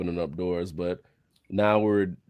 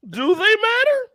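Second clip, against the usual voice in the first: much higher, sing-song question and answer; Seinfeld-style question; 5 dB louder, slower than usual. Pretend. Joking to friends, high sing-song comic voice. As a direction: ask and answer like a sitcom comic. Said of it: "You sounded like Jerry Seinfeld".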